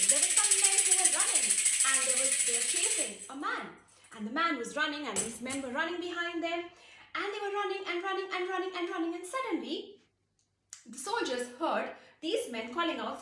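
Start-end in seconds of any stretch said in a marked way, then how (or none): 0.1-9.8 fast, high breathless narration; excited rush. A narrator's reading. Narrator telling the sudden chase, fast and excited. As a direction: race through the chase.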